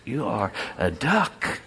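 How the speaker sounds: slowed, deliberate you are a duck with a rising duck; slow insistence. Acted. The duck tells the chicken what he is, slowly.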